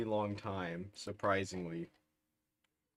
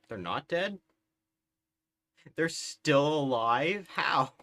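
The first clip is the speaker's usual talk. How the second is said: disbelief; 5 semitones higher, 5 dB louder than usual. Real, not acted. Stunned the nation survives.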